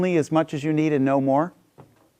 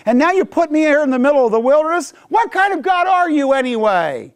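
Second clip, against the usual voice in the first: high, raised, aggrieved cry; bitter complaint to God; 10 semitones higher than usual. Retold or imitated. Freed slaves railing at God in the wilderness, high and bitter. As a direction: shout up at God like a wronged slave. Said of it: "they're angling it toward God".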